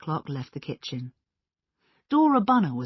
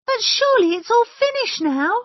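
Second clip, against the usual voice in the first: high, loud, pleading cry; anxious cry; 12 dB louder than usual. A narrator's reading. Mrs Easterbrook to the guests, high anxious cry. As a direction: cry out hoping it's over. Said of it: "cried Missus Easterbrook".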